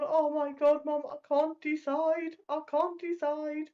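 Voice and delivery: high, slow, whiny mock meltdown; dramatic teen meltdown. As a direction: imitate a teenager wailing in indecision. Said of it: "she was stood there like this, going".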